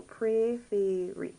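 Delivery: "préférer" slowly, each é as an "ay" sound; clear A sound. Shown as a demonstration. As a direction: stretch out "préférer" with clear "ay" vowels. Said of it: "You get that A sound".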